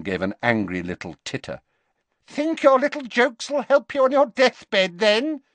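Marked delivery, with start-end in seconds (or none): 2.3-5.4 much higher and louder sneering voice; mock him as a shrill spiteful woman; jeering. Alecto to Dumbledore, jeering at his jokes. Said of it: "she jeered".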